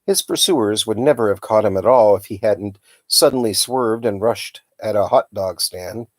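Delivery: plain reading voice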